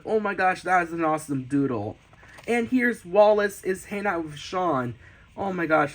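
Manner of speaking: normal talking voice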